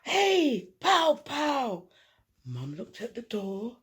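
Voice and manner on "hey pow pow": high, loud boisterous yell; 1 playful shout. A narrator's reading. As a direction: burst in like a rowdy kid. Cousin arrives, playful shout.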